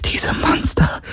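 frightened whisper; voiceless, scared murmur. Acted. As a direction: whisper it, still shaken. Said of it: "flüsterte sie".

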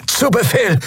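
hohe gepresste Echsenstimme, ächzend. Acted. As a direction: wie ein kletterndes Echsenwesen ächzen. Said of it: "ächzte Quailor". (ächzende Echsenstimme)

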